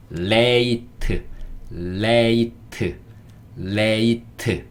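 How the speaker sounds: "레이트" said slowly with a clear L, tongue touching the top; L pronunciation demo. Shown as a demonstration. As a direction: stretch "레이트" out, starting on a tongue-up L. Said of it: "혀가 위에 붙습니다". (혀를 위에 붙인 L 발음 시범)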